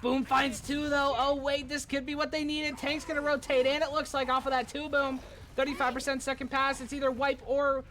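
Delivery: high, urgent esports casting; excited play-by-play. Real, not acted. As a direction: keep the tension up as the play turns. Live play-by-play of game.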